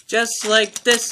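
high, loud, drawn-out brag; boastful. A narrator's reading. Thomas shows off to Oliver, boasting. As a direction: show off, stretching the words. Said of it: "he boasted".

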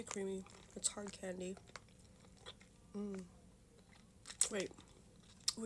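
ordinary talk, nothing marked

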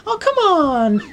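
put-on very high, drawn-out kid whine; pleading kid. Voices a child character.

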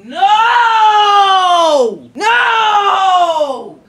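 high loud cry of disbelief, half laughing; shocked yell. Real, not acted. Streamer reacting to Lily losing it, shocked yell. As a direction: yell no at the screen.

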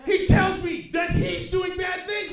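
ordinary talk

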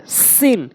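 সিন with a strong, sustained s; সিন, forceful s off the tongue tip; firm seen. Shown as a demonstration. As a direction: say সিন hard, hissing the s. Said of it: "শক্ত করে".

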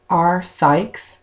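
usual speaking voice

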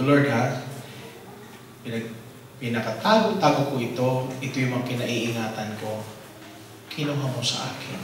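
softly lamenting lost notebooks to God; quiet grieving prayer. Retold or imitated. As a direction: murmur the loss to the Lord.

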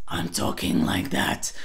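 low growly rattled take; rattle voice. Shown as a demonstration. Coach shows the transfer exercise, rattle voice. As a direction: switch into a deep raspy rattle. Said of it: "try this transfer exercise in one breath".

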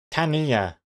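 Tanya said TAN-ee-a; three-syllable Tanya. Shown as a demonstration. Streamer trying X Comics' version, Tanya in three syllables. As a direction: give Tanya an extra middle syllable, ee. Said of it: "X Comics thinks it's three".

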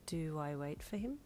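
soft inner question; quiet, uncertain musing; wondering to self. Acted. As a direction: ask it under your breath. Reader's thought, wondering to self.

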